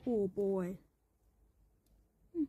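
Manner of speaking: ordinary delivery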